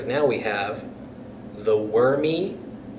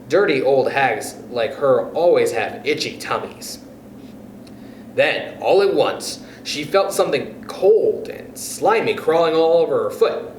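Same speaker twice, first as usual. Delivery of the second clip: ordinary delivery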